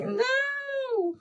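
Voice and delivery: long, high squealed no; mock dread. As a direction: draw it out in a thin high wail. Re-enacting refusal to think about it.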